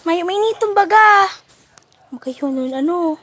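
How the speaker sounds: calling out. Real, not acted. Calling out to Dato.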